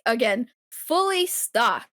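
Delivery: slow, stressed "fully stocked"; emphatic. Shown as a demonstration. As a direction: lean on "fully", drawn out. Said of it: "I just wanna emphasize".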